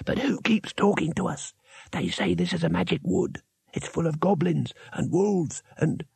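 high, soft nervous voice; nervous junior wizard. A narrator's reading. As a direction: fret quietly to the others. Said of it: "said the most junior wizard".

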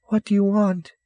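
put-on high child's voice; wary little girl. Girl questioning a stranger in the dark, high wary voice. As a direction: pipe up at a stranger.

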